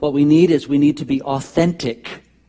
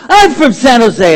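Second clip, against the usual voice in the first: high, loud, drawn-out shout; thrilled to find someone from home abroad; excited hometown greeting; 9 semitones higher, 14 dB louder, slower than usual. Acted. Excitedly greeting fellow San Josean abroad.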